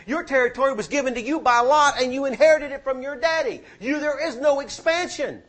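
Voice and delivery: high-pitched, insistent preacher's delivery; forceful preaching. Real, not acted.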